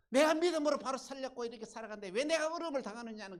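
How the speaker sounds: fast, loud, high-pitched protest; indignant complaint. Acted. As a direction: cry out at the unfairness. Voicing believer's complaint at hardship.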